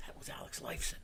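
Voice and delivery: hushed, breathy whisper; retold whisper. Friend whispering to buddy on the street, hushed whisper. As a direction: lean in and whisper it so he won't hear.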